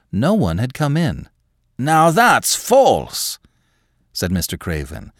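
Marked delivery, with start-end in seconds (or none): 1.8-3.4 high, loud, drawn-out accusation; agitated old man, sharp and slow; sharp, unnatural voice. Acted. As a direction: pounce on each word in a strained, unnatural voice. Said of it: "in a sharp, unnatural sort of voice".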